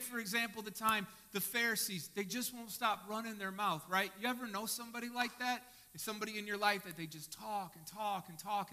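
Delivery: normal talking voice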